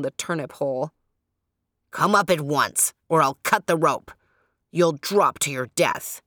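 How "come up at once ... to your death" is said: threatening. A narrator's reading. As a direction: bark threats down the hole. Porcupine man threatens from above.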